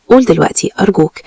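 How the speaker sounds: a touch of begging in a narrated quote; mild pleading. A narrator's reading. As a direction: plead gently, without raising the voice. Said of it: "وبتوسل قلت له".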